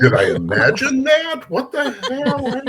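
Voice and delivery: high, slow, laughing disbelief; mock confusion. Drunk confused after release, slow, high and laughing. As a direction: play the drunk dazed and giggling. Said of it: "you could see the".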